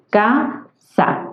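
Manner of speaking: "casa" repeated as ca, sa, with a gap; syllable by syllable. Shown as a demonstration. Teacher recapping the division for students, "casa" syllable by syllable. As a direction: split "casa" into two detached syllables. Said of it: "Entonces tenemos".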